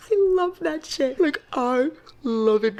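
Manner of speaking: high giddy laughing gush; laughing giddily. Real, not acted. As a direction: giggle it out, slow and delighted. Giddy over book hero.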